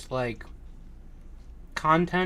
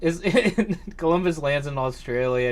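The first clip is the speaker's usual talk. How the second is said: ordinary delivery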